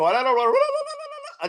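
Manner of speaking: high, rapid-rolling la-la babble; mock gibberish. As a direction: imitate foreign chatter in nonsense syllables. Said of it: "I wanna hear you go".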